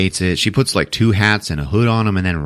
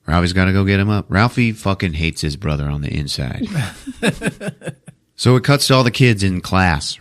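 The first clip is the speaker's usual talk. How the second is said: ordinary talk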